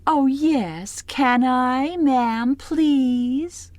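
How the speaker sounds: high, wheedling, eager little voice; sucky eager girl. A narrator's reading. Janine begging the wives for a cookie, high and wheedling. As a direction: suck up to the wives sweetly. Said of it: "And sucky Janine".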